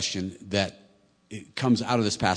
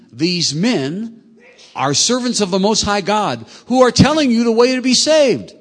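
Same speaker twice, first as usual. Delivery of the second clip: loud raised reading voice; shouting; 9 semitones higher, 8 dB louder than usual. A narrator's reading. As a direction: call it out like a possessed girl.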